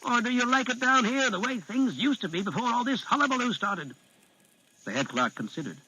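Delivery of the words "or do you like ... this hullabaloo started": higher, insistent Darrow arguing his point; urgent Geg leader. Acted. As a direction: press him hard with the facts. Darrow persuades brother-in-law.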